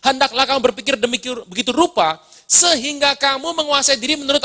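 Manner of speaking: preacher reading scripture aloud to the church. Acted. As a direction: proclaim the verse to the congregation. Reads Romans 12:3 aloud.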